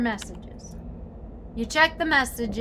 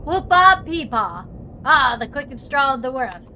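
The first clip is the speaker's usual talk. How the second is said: loud mock Western holler; cowboy whoop; 7 dB louder than usual. Cowboy banter in game.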